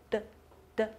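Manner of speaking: “的” as a short, isolated consonant sound; letter sound demo. Shown as a demonstration. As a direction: say “的” briefly, tongue tip behind the upper teeth. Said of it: "它的发音读作". (短促地示范“的”这个辅音)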